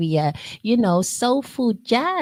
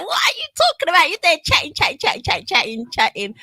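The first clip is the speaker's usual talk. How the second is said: quick, high-pitched South London banter; mock questioning in a South London accent; 7 semitones higher, faster than usual. Host imitating puzzled listeners who ask what they are talking about, South London accent. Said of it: "my South London accent is coming out".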